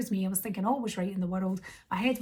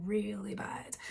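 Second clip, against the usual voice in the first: dramatic whisper; 7 dB quieter, slower than usual. Acted. Telling viewers her day got worse, whispered. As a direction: drop to a whisper on the last words.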